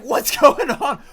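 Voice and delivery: slow, high and loud through a laugh; laughing disbelief. Retold or imitated. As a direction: laugh out the question in amazement. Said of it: "And I was like".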